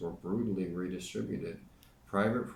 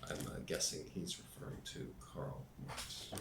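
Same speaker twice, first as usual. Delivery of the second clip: whispered aside; 3 semitones lower, 10 dB quieter than usual. Real, not acted. Muttered aside while reading.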